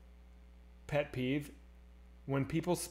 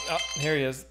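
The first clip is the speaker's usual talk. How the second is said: ordinary delivery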